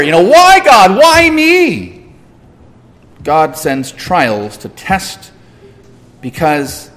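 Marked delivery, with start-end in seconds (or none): 0.2-1.9 high, loud mock plea; hammed-up anguished questioning; questioning God. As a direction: cry out to heaven in acted protest. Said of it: "and we wonder".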